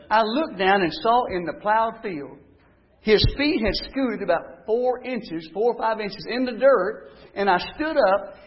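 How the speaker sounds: ordinary talk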